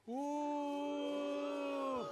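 crowd ooh. Acted. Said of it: "Ready, go".

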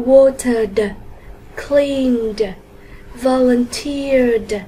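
"watered", "cleaned", "volunteered" drawn out slowly, each closed on a released d; final d sound. Shown as a demonstration. Teacher gives examples of the d ending, "watered", "cleaned", "volunteered" closed on d. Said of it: "còn lại thì chúng ta đọc là d".

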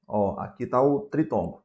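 ordinary delivery

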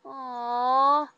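loud drawn "aw"; cooing aww. Retold or imitated. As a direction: Kenzie seeing the hug, loud cooing aww. Friend cooing at hug.

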